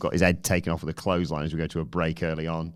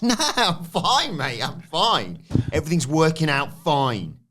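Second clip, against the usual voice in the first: high, slow, sing-song fake-fine voice; mock cheery Finn; 8 semitones higher, 3 dB louder, slower than usual. Finn insisting to Dom he's fine, high fake cheer. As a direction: pretend nothing's wrong, way up high.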